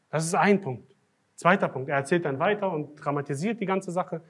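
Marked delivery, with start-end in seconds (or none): none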